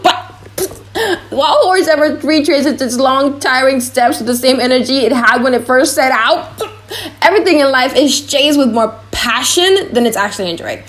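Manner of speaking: giggling while reading; trying to read while laughing; laughing through lines. Real, not acted. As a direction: struggle not to laugh through the speech. Reader cracking up mid-speech.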